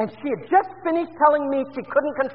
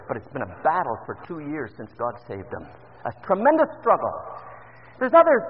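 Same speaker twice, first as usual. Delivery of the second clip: ordinary talk, nothing marked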